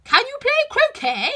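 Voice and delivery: very high, loud royal question; imperious Queen. Acted. The Queen barking a question at Alice, very high. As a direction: demand it of Alice.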